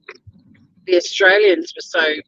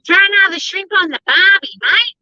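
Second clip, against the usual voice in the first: much higher, hammed-up ocker Australian accent; mock Aussie accent; 13 semitones higher, 4 dB louder than usual. Imitating what Americans expect an Australian to sound like, in a hammed-up broad Australian accent. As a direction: ham up a broad Australian accent like a tourist cliché. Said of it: "they were all expecting to be like".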